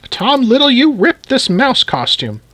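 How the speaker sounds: high-pitched granny voice; scolding granny. Acted. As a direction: scold the child in a high old lady's voice. Granny scolds Tom for rip.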